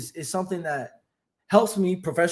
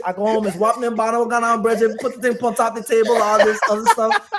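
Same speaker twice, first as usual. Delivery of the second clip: louder, rapid-fire Guyanese home talk; Guyanese accent; 4 semitones higher, 6 dB louder than usual. Acted. Guest showing how the parents talk at home, Guyanese accent. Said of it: "The way my parents talk".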